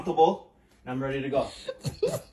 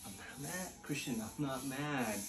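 muttered denial; 4 semitones lower, 17 dB quieter than usual. Acted. Girlfriend muttering to boyfriend she's not mad, very soft. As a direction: mutter it under your breath.

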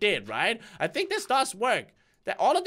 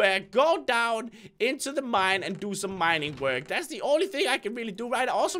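ordinary delivery

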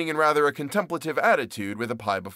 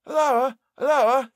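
high, loud call; crying out; 13 semitones higher, 6 dB louder, slower than usual. Acted. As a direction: shout up at the trap door. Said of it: "he cried".

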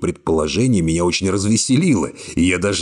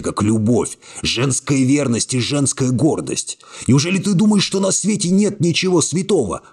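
indignant outburst; 7 semitones higher than usual. A narrator's reading. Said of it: "закричал на неё".

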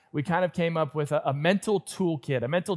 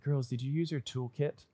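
put-on Girls, did you use your toolkit said softer, holding back frustration; a soft, restrained father's question; 3 semitones lower, 8 dB quieter than usual. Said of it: "I use a soft voice".

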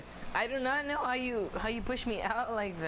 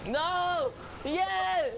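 excited shout; 7 semitones higher than usual. Real, not acted. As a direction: shout it out in delight. Playful gaming banter.

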